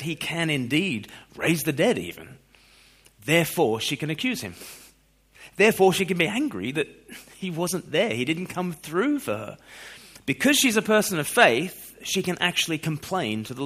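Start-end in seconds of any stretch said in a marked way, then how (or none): none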